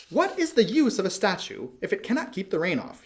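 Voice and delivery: a small bird fed up with the rain; annoyed swallow. A narrator's reading.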